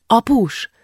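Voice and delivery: put-on little girl calling, lightly out of breath; slightly panting child. A narrator's reading. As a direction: call out eagerly, a touch winded. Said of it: "szólította meg Julcsi kissé lihegve".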